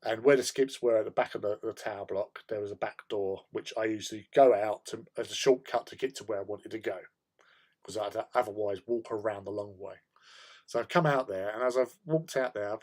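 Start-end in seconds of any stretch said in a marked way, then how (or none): none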